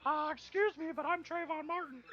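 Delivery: mock character voice. Voicing game character as joke.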